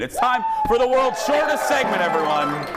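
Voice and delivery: high, loud showman announcement; announcer hype. Real, not acted. Host announcing the segment to the audience, big hype. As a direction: sell the segment like a game-show host.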